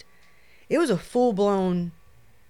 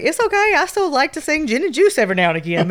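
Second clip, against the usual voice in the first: high joking reassurance; 7 semitones higher, 8 dB louder, faster than usual. Retold or imitated. Mom reassures daughter, jokingly.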